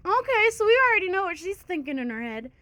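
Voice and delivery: usual speaking voice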